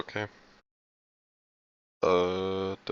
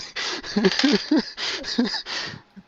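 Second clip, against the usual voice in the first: laughing; 6 dB louder than usual. Real, not acted. GM laughing at the situation.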